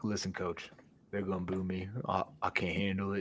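mock nervous whimpering to coach; whiny quarterback. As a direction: imitate a scared player begging the coach. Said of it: "he's like".

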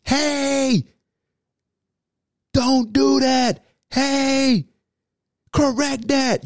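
high-pitched sideline coach yell; drawn-out, high call to carry over distance. Shown as a demonstration. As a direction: yell corrections to a player far across the field. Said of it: "You gotta yell".

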